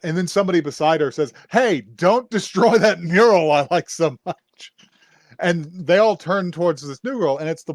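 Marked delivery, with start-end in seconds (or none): 1.5-4.7 loud, higher, drawn-out mock shout; calling out in protest. Somebody beside her defends the mural, calling out in protest. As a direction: slowly holler it as an outraged bystander.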